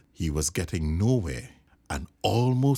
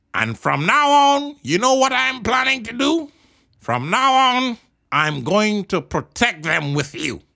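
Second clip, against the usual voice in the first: high, loud kangaroo exclaiming; eager agreeing kangaroo; 7 dB louder than usual. A narrator's reading. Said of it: "said the big kangaroo".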